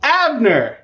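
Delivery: high, drawn-out name call; mock yell. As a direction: imitate hollering down a hill, without full volume. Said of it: "he yells down".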